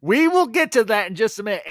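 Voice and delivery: loud, high holler to cut someone off; exasperated shout. Real, not acted. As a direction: call out firmly over an interruption. Calls out to impatient Jeff in chat.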